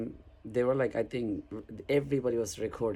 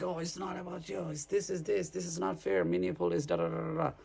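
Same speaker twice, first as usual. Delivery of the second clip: mock protester; 6 semitones higher than usual. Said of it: "cut me off, like".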